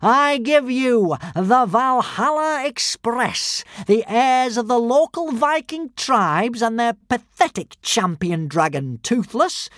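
high, slow, mocking announcement; sneering showman calling to the arena; villain's yell. A narrator's reading. Alvin mocks the Vikings to the crowd, yelling. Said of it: "yelled Alvin the Treacherous".

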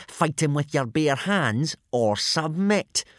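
mock the loser; sneering. A narrator's reading. Snotlout taunts Hiccup during the fight, mild sneer. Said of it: "sneered Snotlout".

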